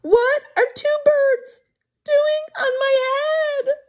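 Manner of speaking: high, loud cartoon outrage; upset elephant. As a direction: squeal it in comic dismay. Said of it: "Elephant is not happy".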